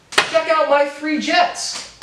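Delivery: high, slow, smug quote of the billionaire; mocking Mark Cuban. Vlogger mocking Mark Cuban's jets boast, high and slow. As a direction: drag it out, mocking his bragging. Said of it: "Mark Cuban quotes".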